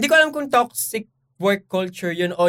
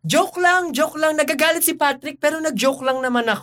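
higher, breezy brush-off; mock dismissive boss; 8 semitones higher than usual. Boss laughing off her anger at Patrick, mock breezy. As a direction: shrug it off as nothing.

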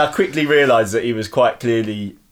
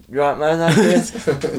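retold sheepish reply, pitched up; higher, tentative greeting, trying to avoid a chat; a bit sheepish; 5 semitones higher than usual. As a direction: play a wary, polite greeting back. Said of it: "being a bit sheepish with him".

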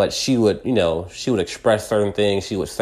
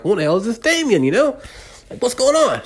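much higher, fast incredulous question; baffled. Retold or imitated. Wondering about the Damien tattoo, high incredulous. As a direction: demand it in high disbelief.

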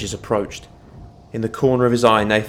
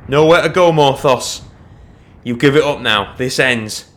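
raised, forceful confrontation; loud challenge; 4 semitones higher, 9 dB louder than usual. A narrator's reading. Watchwoman cornering a fugitive on a roof, loud challenge. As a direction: call out loud and high, then firm it down.

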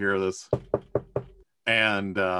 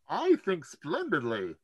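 Brewer voice; 5 semitones higher, 3 dB quieter than usual. Acted. Brewer answers.

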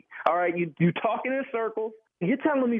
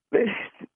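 short laugh. Real, not acted. [name] laughs at the claim.

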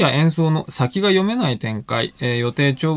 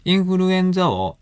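high, level Tochigi-accented インフルエンザを with a final drop; dialect schoolchild reading aloud; 4 semitones higher than usual. Shown as a demonstration. Showing class reading of インフルエンザを, Tochigi-accented schoolchild recitation. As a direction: recite インフルエンザを in a child's rural accent, keeping the i. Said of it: "国語で音読してくださいっていう時には".

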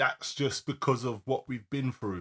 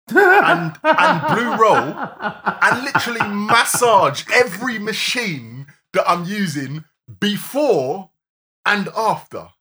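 laughing; 8 semitones higher, 8 dB louder than usual. Real, not acted. Laughing exasperated gym story.

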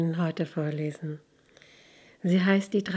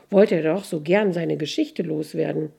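ordinary delivery, nothing marked

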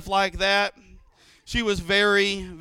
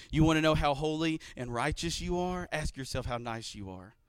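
much softer, gentler repeat; softer second take; 6 semitones lower, 8 dB quieter than usual. Shown as a demonstration. Pastor retrying the point to congregation, soft. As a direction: lower the voice and let it land. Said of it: "I'm gonna try that again".

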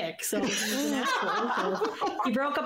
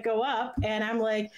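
normal talking voice

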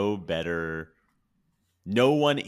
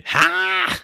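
loud, high, barked "Ha!"; one big exaggerated burst of laughter; emphasized laugh; 11 dB louder than usual. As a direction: punch out a high, loud cackle. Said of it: "you emphasized the laugh there".

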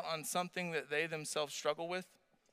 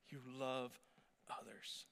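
whispered point; 3 semitones lower, 10 dB quieter, slower than usual. Retold or imitated. Paul's point, whispered ending.

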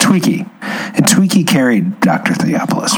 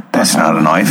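put-on lower Aussie accent; 8 semitones lower than usual. Quoting Crocodile Dundee to his cohost, in an Aussie accent. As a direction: growl it like the Aussie hero. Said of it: "Crocodile Dundee quote".

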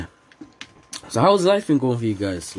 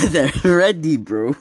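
higher, slow, through real laughter; laughing; 4 semitones higher, 3 dB louder, slower than usual. Real, not acted. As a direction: crack up while saying it. Cracking up at own joke.

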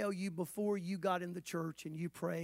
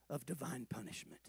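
whisper; 7 semitones lower, 12 dB quieter than usual. Shown as a demonstration. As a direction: whisper the words of divine punishment. Whispered scripture affirmation.

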